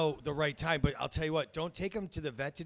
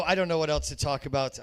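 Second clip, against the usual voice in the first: normal talking voice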